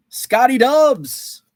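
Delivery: loud, high, slow drawn-out name; hyped call. Real, not acted. Host to chat, hyping the star player, excited. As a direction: belt the nickname like an announcer.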